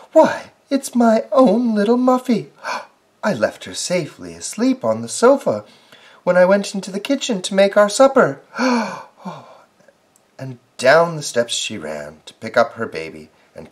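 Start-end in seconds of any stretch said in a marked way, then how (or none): 0.1-8.6 high, loud, relieved mother; excited mother. Acted. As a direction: gush with joy, fast. Mother finds lost baby.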